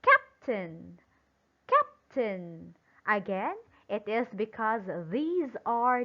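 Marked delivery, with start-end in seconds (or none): 0.0-2.7 captain with a punched CAP and lower, falling second syllable; stress on first syllable. Shown as a demonstration. Teacher showing how to read it, first syllable stressed in captain. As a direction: hit CAP, then drop in captain. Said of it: "it must be read as".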